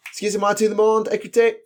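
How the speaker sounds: high, brisk French teacher's announcement; teacher calling class. Retold or imitated. Teacher switches to French on a class, calling for attention. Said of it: "when you teach and you just go".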